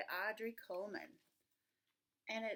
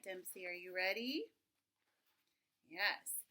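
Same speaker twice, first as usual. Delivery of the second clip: usual speaking voice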